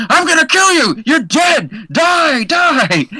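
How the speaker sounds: high mock yell of a man threatening to kill; retold screaming man. Man yelling threats at a spider in his flat, high mock yell. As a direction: shout it like a frantic man fighting something. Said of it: "a man screaming".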